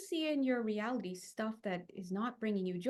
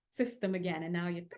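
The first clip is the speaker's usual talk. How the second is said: ordinary talk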